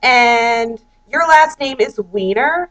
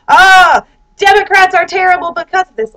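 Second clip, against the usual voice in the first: mock outrage; 6 semitones higher, 4 dB louder than usual. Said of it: "people on the right side are like".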